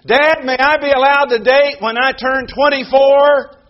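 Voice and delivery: put-on pleading child. Child begging parent in sermon.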